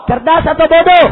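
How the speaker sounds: loud, pressing either-or question; rallying shout. Real, not acted. Preacher asks if the decree is smart or stupid, rallying shout. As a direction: demand the crowd's verdict. Repeats louder to the crowd.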